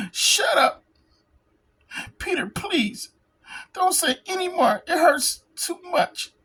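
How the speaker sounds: Kitty silently begging Peter to stop, mildly pained; pained inner plea. Acted.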